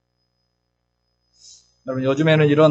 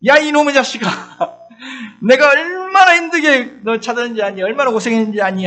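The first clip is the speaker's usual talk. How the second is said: higher, louder mock scolding with a laugh; scolding mother; 7 semitones higher, 5 dB louder than usual. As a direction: play the old-time mom yelling at her lost kid. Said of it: "하면서 엉덩이 때리죠". (잃어버린 아이를 찾은 엄마의 웃음 섞인 호통)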